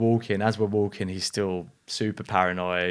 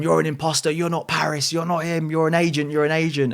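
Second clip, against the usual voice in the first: mock paranoid yell; 7 semitones higher than usual. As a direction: accuse him, sure he's a spy. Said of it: "shouting at me".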